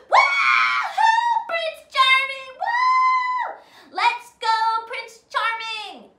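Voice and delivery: very high, loud whoops and chants; cheering crowd. Acted. Fans cheering Prince Charming, shouting. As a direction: cheer like a fan in the stands.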